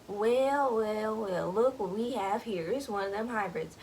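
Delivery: lightly voiced hunter savoring his find in a country accent. Hunters gloating over Gus, in a light country accent. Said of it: "country accents".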